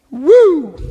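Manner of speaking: high, loud whoop; a loud excited whoop. Real, not acted. To the laughing audience, loud whoop.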